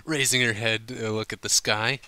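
ordinary delivery

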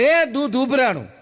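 high, loud alarmed shout; loud yell. Retold or imitated. Husband shouting to send wife running, loud yell. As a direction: yell it out like the milk is boiling over. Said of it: "તો જોરથી રાડ પાડવાની".

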